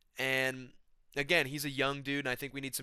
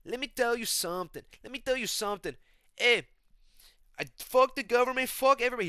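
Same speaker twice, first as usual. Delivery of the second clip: Renato Moicano; 11 semitones higher, 7 dB louder than usual. Acted.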